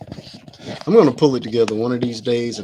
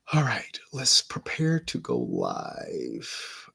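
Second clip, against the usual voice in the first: whisper; 7 dB quieter, much slower than usual. Real, not acted. Quiet self-talk before going live.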